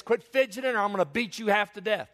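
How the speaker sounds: high mock scolding; threatening grandma. Grandma to grandchild sharing her bed, acted high-pitched threat. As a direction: threaten the squirming child, hammed-up. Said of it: "She'd say this".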